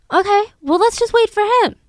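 imitating officers shrugging it off; mock casual cop. Cops deciding to wait.

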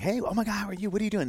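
imitating his overexcited younger self; eager puppy greeting. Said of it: "I was just kinda like".

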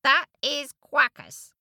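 duck voice. Acted. Ducks protesting.

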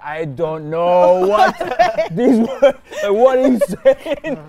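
slow, loud, rising bafflement; mock exasperated dad. Dad baffled by child's fancy words, mock exasperated. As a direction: stretch each word in mock outrage.

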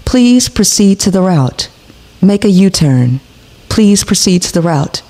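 low robotic navigation prompt; flat automated sat-nav announcement; Siri voice. Pretend. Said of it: "I would have Siri tell your ass".

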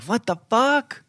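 ordinary talk, nothing marked

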